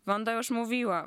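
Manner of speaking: plain reading voice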